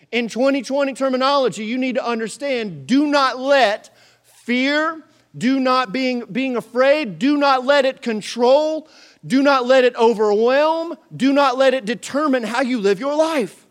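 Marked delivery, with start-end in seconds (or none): 2.9-13.7 emphatic preaching. Acted. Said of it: "preaching emphatic repetition".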